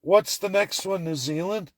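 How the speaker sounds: normal talking voice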